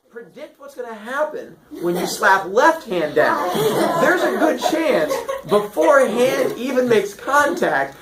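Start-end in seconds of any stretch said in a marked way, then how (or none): none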